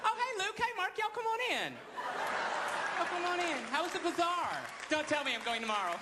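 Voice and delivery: effeminate Southern Jesus, high. Retold or imitated. Jesus greeting Luke and Mark, high camp Southern. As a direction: squeal a welcome like a Southern host. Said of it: "effeminate Southern guy".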